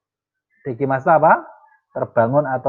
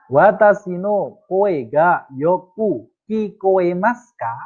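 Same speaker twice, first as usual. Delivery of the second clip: slow, clear Japanese question, higher; slow model reading; 5 semitones higher, slower than usual. Shown as a demonstration. Teacher reads the Japanese example slowly and clearly for students. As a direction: sound out each word for learners.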